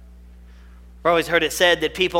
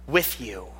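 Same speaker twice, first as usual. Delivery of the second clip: hushed promise; 8 semitones lower, 7 dB quieter than usual. Acted. God's promise, whispered close.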